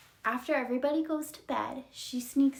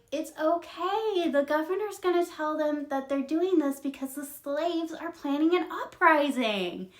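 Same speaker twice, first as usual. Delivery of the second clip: higher, sing-out brush-off; dismissive reassurance; 7 semitones higher than usual. Retold or imitated. Officers explain their cover story, airily reassuring. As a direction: wave the worry away.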